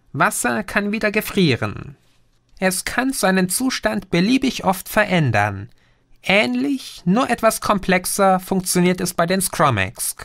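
a patient know-it-all explaining; Green's voice. Acted. (leicht höher gesprochener Außerirdischer, belehrend)

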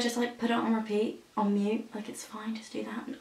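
hushed, almost whispered secret; conspiratorial whisper. Real, not acted. Vlogger telling fans to loop the song on mute, whispering.

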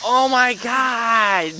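slow, loud oh my God; drawn-out surprise. Real, not acted. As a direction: stretch it out in shock. Surprise then laugh.

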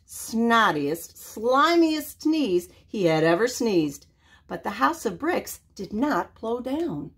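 ordinary delivery

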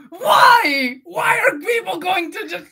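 hammed-up high crying wail; mock anguish. Streamer to a teasing viewer, mock wailing cry. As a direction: wail it like tortured.

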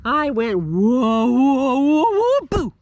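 high, loud spooky ghost voice; ghostie voice. Acted. Ghostie boasts about scaring ghoulies, high spooky voice. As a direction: brag like a silly little ghost. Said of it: "Said ghostie number one".

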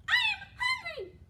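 high, tiny mouse squeak; squeaky mouse. Acted. Teacher reading to kids voices the mouse, squeaky high. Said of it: "squeaked the little mouse".

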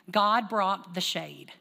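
slow, weighty God brought the shade; slow sermon punchline; emphatic slow statement. Shown as a demonstration. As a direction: land each word of "God brought the shade" slowly. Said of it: "Everybody say".

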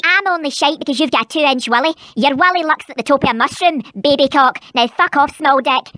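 deep, growling Scottish mum; gruff low mother voice. Acted.

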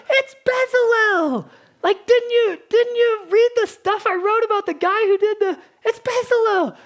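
Moses sighing at an ignorant reader; mock exasperated correction.

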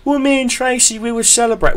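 high, put-on girly voice; mock ex excuse. Ex explaining her week away in a high mocking voice.

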